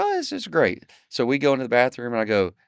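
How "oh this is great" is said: high, delighted customer voice; high bright "oh, this is great" from the couple; customers' reply. Retold or imitated. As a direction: pitch it up like thrilled homeowners.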